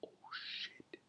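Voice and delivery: barely audible whispered curse; dismayed hushed curse. Real, not acted. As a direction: breathe it out in dismay. Reader's aside in whisper.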